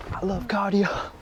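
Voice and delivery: high, slow sarcastic gush. Real, not acted. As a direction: fake enthusiasm, dripping sarcasm. Dreading forty minutes of cardio.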